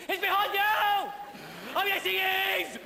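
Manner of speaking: high, loud panto yell; panto crowd shout. Retold or imitated. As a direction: bellow it at the stage. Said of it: "It's us going".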